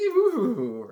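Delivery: high, drawn-out "wooo"; light, hooting mock-mystical woo. Mocking spooky noise after bless me.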